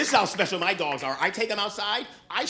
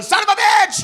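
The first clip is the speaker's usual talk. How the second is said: sudden loud, high-pitched shout; startled cursing yell; 13 semitones higher, 14 dB louder than usual. Acted. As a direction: explode like a panicked man with a bat. Startled man with bat yells.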